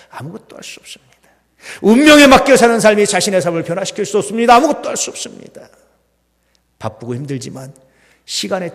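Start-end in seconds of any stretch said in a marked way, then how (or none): 0.1-1.1 hushed whisper on 아무것도, rising at the end. Acted. Pastor hushing the congregation, whispered warning. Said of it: "repeated line whispered". 4.6-5.7 much higher, voiceless edge, 아무것도 pushed up; high cry. Acted. Pastor crying out the same warning, high and urgent. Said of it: "repeated line cried out".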